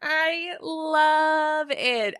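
high, very slow gush; delighted. Real, not acted. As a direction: savour it with delight. Delighted by the rap.

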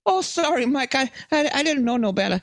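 put-on a cowering old man making excuses; groveling Fauci. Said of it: "he'll be like".

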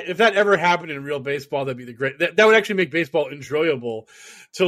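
ordinary delivery

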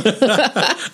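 high giggle; giddy laugh. Real, not acted. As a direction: burst of nervous laughter. Giddy laugh after rating.

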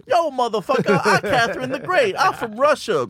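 high, brash comedian impression; hammed-up swaggering introduction; Tiffany Haddish. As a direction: pitch it up with streetwise attitude. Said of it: "did fucking Tiffany Haddish".

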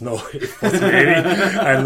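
laughing while talking; words breaking into laughter. Real, not acted. Laughs at own phrasing.

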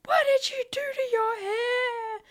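put-on high, slow, loud wailing child; childish wail. Child wailing at mum over her new haircut. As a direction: wail it like a shocked little kid. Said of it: "And I was like".

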